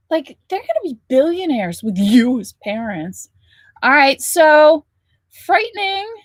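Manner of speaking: ordinary delivery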